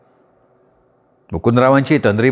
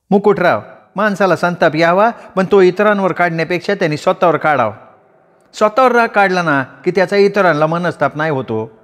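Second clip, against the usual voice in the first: put-on higher-pitched voice for the young woman; the young woman calmly admonishing Mukutrao, higher voice; young woman's voice; 6 semitones higher than usual. A narrator's reading. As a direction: lift the voice into the woman, firm and steady.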